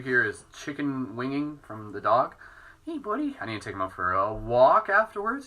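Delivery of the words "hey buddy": much higher cooing greeting. Real, not acted. Owner greeting her dog in a high coo.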